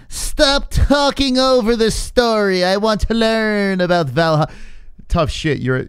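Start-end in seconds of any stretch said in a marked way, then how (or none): 0.1-4.5 put-on high, slow whining child; mock a bratty twelve-year-old; whiny kid voice. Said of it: "twelve-year-olds in the comment section that say".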